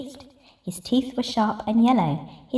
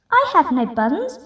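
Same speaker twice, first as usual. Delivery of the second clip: higher, louder little squirrel squeak; small squirrel voice; 6 semitones higher, 4 dB louder than usual. Acted. Squirrel answering the highway rat in a small high voice. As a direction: pipe up like a scared squirrel.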